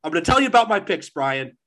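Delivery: high, loud mock-furious insistence; mock angry shout. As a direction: yell it at your co-host, half joking. Mock on-air quarrel with Brian.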